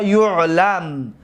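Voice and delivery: یعلم said "yu'lam", with "u" first; slow, falling "yu'lam" for یعلم; passive-voice form. Shown as a demonstration. Teacher repeats the passive for students, یعلم pronounced "yu'lam".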